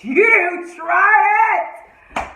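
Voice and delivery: Jamaican-tinged shout of indignation. Real, not acted. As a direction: call her out at full volume. Ranting at TV character.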